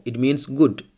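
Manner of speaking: ordinary delivery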